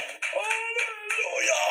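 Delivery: long, high, drawn-out shout; huge shout. Retold or imitated. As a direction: cry it out at the top of your voice. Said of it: "Basta grabe yung boses". (napakalakas at mahabang sigaw)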